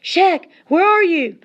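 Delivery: high, loud call through the storm; panicked shout, slowed; shouting for brother. Acted. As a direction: call out desperately into the snow. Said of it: "he shouted".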